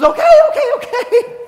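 very high, loud, frantic okays; acted high-pitched fright; panicked surrender. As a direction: squeal it, giving in to a scary ghost. Scrooge gives in to screaming ghost.

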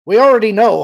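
forceful and exasperated; irritated growl. A narrator's reading. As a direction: cut him off angrily. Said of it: "Han growled".

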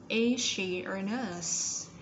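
slow, "Is" joined into "she" with an S sound; slow model question. Shown as a demonstration. Teacher modelling the linked sound, very slow. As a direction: say "Is she a nurse?" slowly, "Is" blending into "she". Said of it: "S ending sound".

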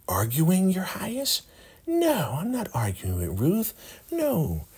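high falsetto mock innocence; Joan. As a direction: deny it in a squeaky put-on voice. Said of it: "You know how she talks".

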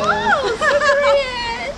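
high, slow amazed exclamation; excited. Acted. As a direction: gush with exaggerated wonder. Exaggerated amazed reaction.

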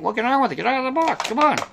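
high, pushy mock-impatient voice; impatient viewers. Viewers telling the host to unbox, mock-impatient and high. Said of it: "a lot of people are saying".

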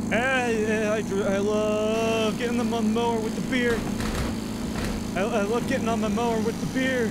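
put-on drunk neighborhood dad. Imitating the drunk dad down the street, boozy dad voice. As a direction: slur it slowly like a happy drunk on his mower. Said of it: "like, a lawnmower drunk".

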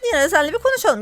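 ordinary talk

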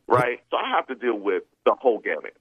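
ordinary talk, nothing marked

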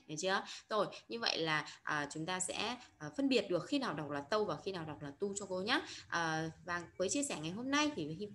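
normal talking voice

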